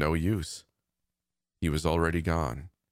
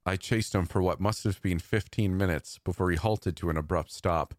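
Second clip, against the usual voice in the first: plain reading voice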